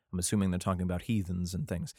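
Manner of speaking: normal talking voice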